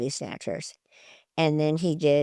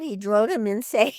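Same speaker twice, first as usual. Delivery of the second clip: usual speaking voice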